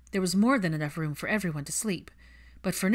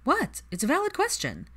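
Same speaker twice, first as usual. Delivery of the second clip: defensive surprise; 10 semitones higher than usual. Acted. Cho defends her question.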